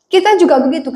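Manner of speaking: usual speaking voice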